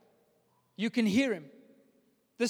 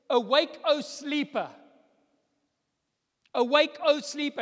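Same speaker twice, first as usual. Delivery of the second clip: raised call, higher; rousing call; 5 semitones higher, 5 dB louder than usual. Acted. Preacher calling sleepers in church awake, raised voice.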